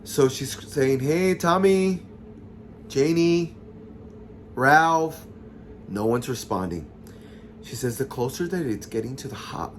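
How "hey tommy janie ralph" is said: raised, drawn-out calls to friends; searching call-out with stretched names. Retold or imitated. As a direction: call each name out into the house, higher and louder. Calling for missing friends in house.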